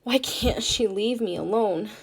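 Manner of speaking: usual speaking voice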